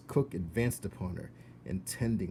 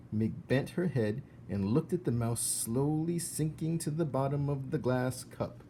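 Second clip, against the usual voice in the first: usual speaking voice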